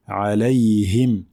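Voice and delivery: alayhim slow, full i in him; full kasra. Shown as a demonstration. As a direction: stretch the i in alayhim. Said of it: "c'est une kasra complète".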